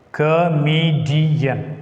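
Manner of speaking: "Comedian" drawn out slowly, each syllable separated. Shown as a demonstration. Teacher showing syllables of an example word, slow and divided. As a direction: stretch "Comedian" into co-me-di-an.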